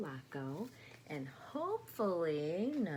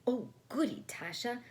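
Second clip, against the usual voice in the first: high bright squeal of glee; doll chirping; 7 semitones higher, 4 dB louder than usual. Acted. The doll chirps happily at the goat cart idea. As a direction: chirp it up like a delighted toy. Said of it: "the doll chirped".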